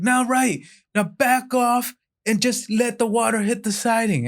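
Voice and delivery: high urgent coaching call; called-out instructions. Acted. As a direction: keep calling directions over a fire. Neighbor calling out hose directions.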